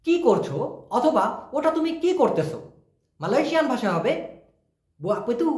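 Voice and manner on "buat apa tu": "Buat apa tu" said clipped, with "apa" shortened to "pe" and a rising "tu"; short Malaysian speech. Shown as a demonstration. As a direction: run "Buat apa tu" together fast, like a local, ending on a rise. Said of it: "ঠিক এভাবেই short করে বলে". ("Buat apa tu" ছোট করে, শেষে "tu" উঠিয়ে)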